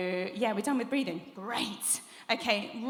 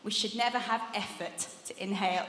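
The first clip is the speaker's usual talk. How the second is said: usual speaking voice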